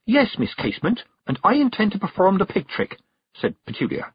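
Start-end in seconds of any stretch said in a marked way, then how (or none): 0.1-3.0 high, firm announcement; loud. A narrator's reading. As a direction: declare it publicly with resolve.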